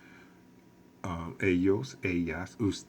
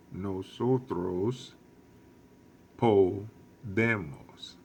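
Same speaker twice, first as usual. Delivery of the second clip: slow model pronunciation; 4 dB louder, slower than usual. Shown as a demonstration. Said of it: "teacher models Spanish verb forms".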